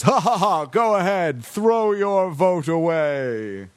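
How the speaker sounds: slow, mocking alien villain voice; gloating alien. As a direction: draw out throw your vote away, villainous. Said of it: "the big one-eyed octopus alien says".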